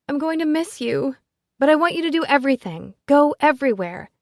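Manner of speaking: put-on high, fast gushing girl; excited gushing. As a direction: bubble over with excitement. Ellie flings vibrating self.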